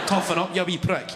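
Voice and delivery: ordinary talk, nothing marked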